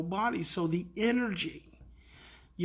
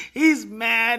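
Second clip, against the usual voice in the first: normal talking voice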